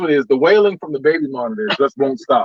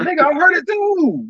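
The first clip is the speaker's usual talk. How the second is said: mock scared mom. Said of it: "She might be scared too".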